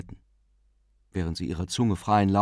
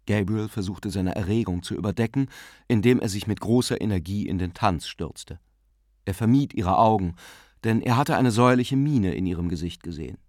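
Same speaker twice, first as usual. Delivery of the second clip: usual speaking voice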